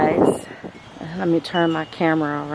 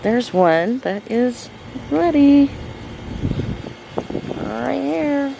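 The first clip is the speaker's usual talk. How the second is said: excited; 6 semitones higher, slower than usual. Real, not acted. First ripe strawberry found.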